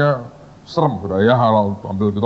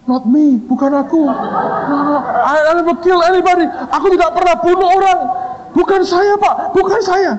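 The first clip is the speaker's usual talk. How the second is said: panicked denial. Retold or imitated. Terrified student pleading he killed nobody, high and frantic. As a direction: plead your innocence in a panic.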